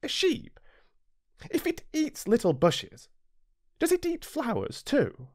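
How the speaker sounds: put-on high boyish voice, insistent; a small prince presses the pilot with a sudden question; little prince demanding. Narrator voices the little prince, high and insistent. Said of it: "he demanded".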